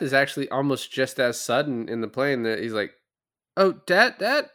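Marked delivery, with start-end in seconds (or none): 3.6-4.5 high, loud, anguished calling out; desperate cry for dad. Retold or imitated. Son calls to his dying father, high anguished cry. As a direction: cry out to him as he slips away.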